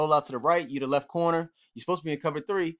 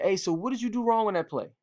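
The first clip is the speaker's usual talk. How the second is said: ordinary talk, nothing marked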